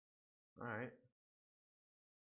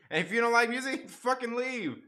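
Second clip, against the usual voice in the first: higher, louder, laughing through it; joking outrage, giggling; laughing rant; 10 semitones higher, 4 dB louder than usual. Real, not acted.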